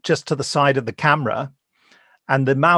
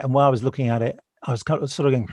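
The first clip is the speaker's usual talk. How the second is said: ordinary talk, nothing marked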